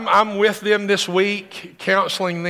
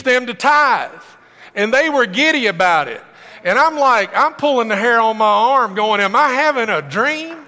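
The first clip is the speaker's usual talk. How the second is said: higher and louder, fervent; preaching at full force; 5 semitones higher, 4 dB louder than usual. Real, not acted. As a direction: preach it with rising excitement.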